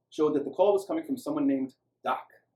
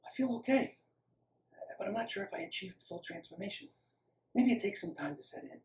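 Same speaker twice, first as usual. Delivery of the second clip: hushed, higher and halting; eerie half-whisper, dazed; hoarse, eerily quiet; 6 semitones higher, 6 dB quieter than usual. A narrator's reading. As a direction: speak softly as if dazed after an ordeal. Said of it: "Lex's voice was hoarse and eerily quiet".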